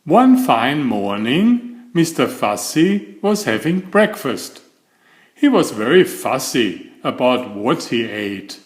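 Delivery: ordinary delivery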